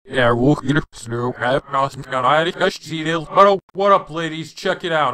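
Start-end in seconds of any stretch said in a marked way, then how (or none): 2.6-5.1 speech played in reverse; backwards speech. Shown as a demonstration. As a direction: talk backwards, words garbled. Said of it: "I can talk backwards".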